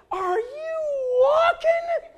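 high, loud, stretched-out disbelief; incredulous cry. Retold or imitated. Swimmer seeing his friend saunter through the lake, incredulous cry. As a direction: squeal the question slowly in disbelief.

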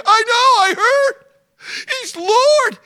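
very high, loud eager father; excited old father. Acted. Father interrupting son Levi, high and loud. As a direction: jump in before he finishes.

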